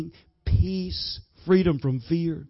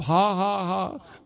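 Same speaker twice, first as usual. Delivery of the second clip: forced laughter; much slower than usual. Shown as a demonstration. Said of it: "prime your pump".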